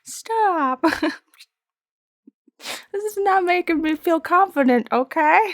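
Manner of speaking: high-pitched mock-hurt complaint; retold protest. Streamer protesting boyfriend's teasing, whiny.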